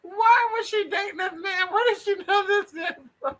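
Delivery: very high, laughter-squeezed questions; incredulous laughter. Real, not acted. Host reacting to a friend's shocking story, incredulous laughing squeal.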